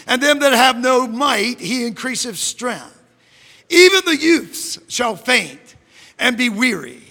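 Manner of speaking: ordinary talk, nothing marked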